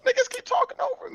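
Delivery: high-pitched complaint. Real, not acted. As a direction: voice shoots way up in a whiny protest. Repeats the complaint about interruptions.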